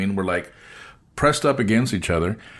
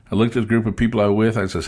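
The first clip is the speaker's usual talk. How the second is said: ordinary talk, nothing marked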